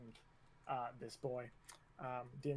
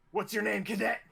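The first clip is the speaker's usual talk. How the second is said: loud, higher drill-sergeant demand; barking instructor; 4 semitones higher, 4 dB louder than usual. Acted. Instructor stops at next cadet, loud demand. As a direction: bark the question at a cadet.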